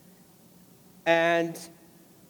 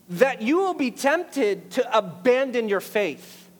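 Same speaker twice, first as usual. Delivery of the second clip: raised, higher preaching voice driving each point home; fiery preaching; 5 semitones higher than usual. Acted. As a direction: preach it out to the congregation with full force. Preaching at full force.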